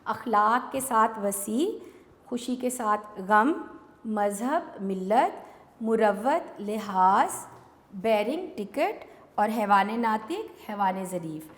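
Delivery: very slow, each pair stretched out for the class; teacher slowly repeating the answers; slow repetition. Shown as a demonstration. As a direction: repeat it slowly so pupils can copy. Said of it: "میں ایک مرتبہ پھر سے دہرا رہی ہوں".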